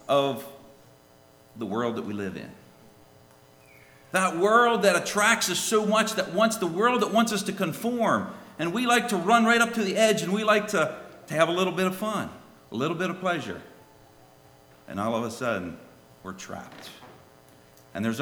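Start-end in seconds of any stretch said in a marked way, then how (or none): 4.1-13.6 high, loud fervent preaching; preaching at full force. Acted. Preacher warning the church about the world, fervent preaching. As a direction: press the warning home, raised and urgent.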